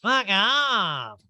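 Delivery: high, loud retort; mock irritated outburst. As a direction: blurt it out as a put-on diva outburst. Mimicking Erika snapping at stylist.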